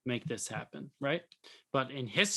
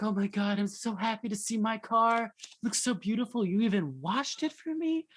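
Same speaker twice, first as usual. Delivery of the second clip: gushing customer; 7 semitones higher than usual. Retold or imitated. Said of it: "She's like".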